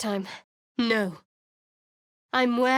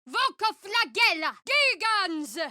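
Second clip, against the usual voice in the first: much higher, commanding cry; spell incantation; 10 semitones higher than usual. Acted. Cleric calling down power on enemies, commanding cry. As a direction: hurl the words like a summons.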